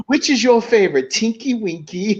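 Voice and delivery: high playful mock ask; mock childish question. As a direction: coo it like a kids' show host. Retelling Will Ferrell's MP questions.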